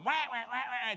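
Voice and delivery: comic honking babble; Charlie Brown's parents. Retold or imitated. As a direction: imitate wordless cartoon-adult nagging. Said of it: "she sounds like Charlie Brown's parents".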